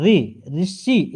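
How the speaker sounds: री and ऋषि said slowly, syllable by syllable; sounding out letters. Shown as a demonstration. Teacher spelling ऋषि aloud to students, slow and deliberate. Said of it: "ऋषि में ऋण लगती है".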